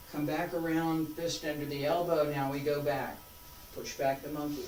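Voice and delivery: calm called-out form names; serene tai chi cues. Shown as a demonstration. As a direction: call each move calmly.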